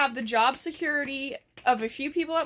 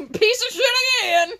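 high, louder fake disgust; mock exasperated yell; 9 semitones higher, 7 dB louder than usual. Imitating an annoyed reaction to her friend, mock yell.

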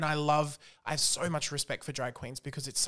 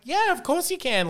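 obliging politician; 14 semitones higher, 6 dB louder than usual. Retold or imitated. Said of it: "And he's like".

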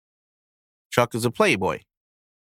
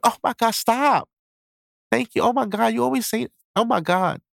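put-on flustered girl; 7 semitones higher than usual. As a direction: gush like a blushing girl. Girl blushing at compliment.

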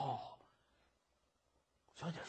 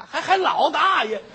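laughing dismay; 10 semitones higher, 12 dB louder than usual. Acted. Scholar aghast, laughing.

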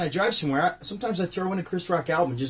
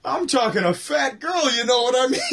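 trying to stay in character; in character as Rodney; 9 semitones higher than usual. Retold or imitated. Cracking up while playing Rodney for viewers. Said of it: "I'd love to stay in character".

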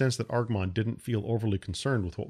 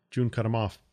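ordinary delivery, nothing marked

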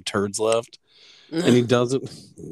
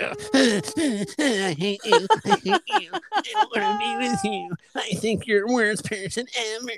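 sulky dog voice; 13 semitones higher than usual. Pretend. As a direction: whine it out as a resentful little dog. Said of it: "He's like".

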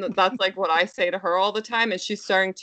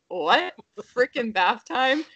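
put-on higher, questioning echo of the toddler; mock disbelief; 5 semitones higher, slower than usual. Parents react to toddler's new word, high incredulous question. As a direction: repeat it as a baffled parent's question. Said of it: "And we're like".

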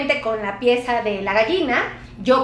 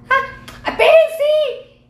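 sudden wait; 14 semitones higher, 5 dB louder, much slower than usual. Real, not acted. Stops herself while leaving.

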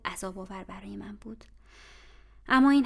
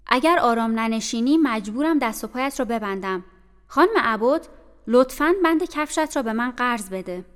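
stern warning; 3 semitones higher, 9 dB louder than usual. Acted. Bessie threatens the restrained girl.

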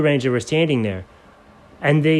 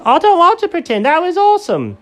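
squeaky Jenkins; 4 dB louder than usual. Acted.

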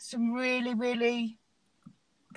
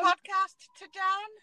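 high, loud mock call-out; mock radio hailing; 8 semitones higher, 3 dB louder than usual. Co-host hailing the silent guest, playful calling out. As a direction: hail the missing caller like mission control.

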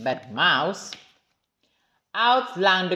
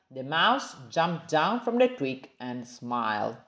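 ordinary delivery

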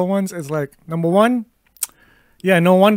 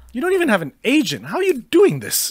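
incredulous; 7 semitones higher than usual. Retold or imitated. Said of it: "I'm like".

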